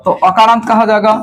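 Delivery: normal talking voice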